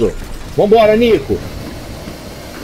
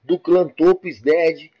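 softer, relaxed channel host read; YouTuber intro; 7 semitones lower, 7 dB quieter than usual. Acted. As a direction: talk to your subscribers like a host. YouTuber intro and outro to viewers. (apresentador de canal, tom descontraído)

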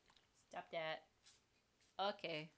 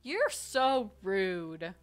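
drawn-out mock offense; 10 dB louder, much slower than usual. Real, not acted.

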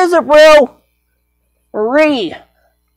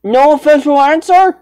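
ordinary talk

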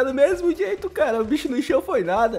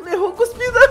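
laughing high at the missed spit; 12 semitones higher, 5 dB louder than usual. Real, not acted. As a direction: burst into a giggle. Inimigo erra a cusparada. (rindo alto)

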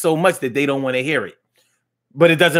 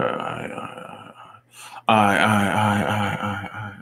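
low deep voice; 4 semitones lower, 4 dB quieter than usual. Shown as a demonstration. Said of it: "deep low voice".

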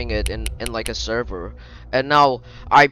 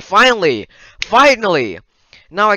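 loud joyful finally; excited shout; 9 semitones higher, 9 dB louder than usual. Real, not acted. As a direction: cheer with relief. Relief at finishing the game.

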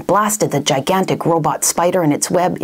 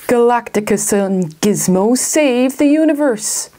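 dramatic title; 5 semitones higher than usual. Acted. Book-within-book title announced.

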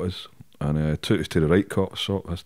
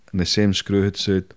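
normal talking voice

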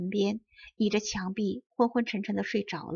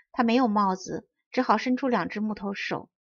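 plain reading voice

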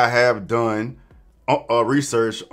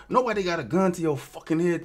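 usual speaking voice

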